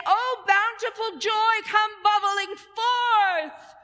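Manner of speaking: high, slow, loud chant of joy; joyful exclamation. Shown as a demonstration. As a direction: call it out big and joyful. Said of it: "you're going to say".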